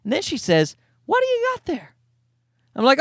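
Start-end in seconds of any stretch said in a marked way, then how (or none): 1.1-2.0 high, put-on voice of the neighbor lady; mock neighbor voice. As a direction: ask it like the prim upstairs neighbor. Neighbor lady asks about gimbal.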